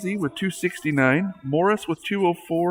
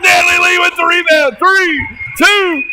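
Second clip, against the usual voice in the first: much higher, loud, fast shouting; shouted game call; 10 semitones higher, 6 dB louder than usual. Real, not acted. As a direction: yell every miss and the countdown. Last-second shots, shouted call.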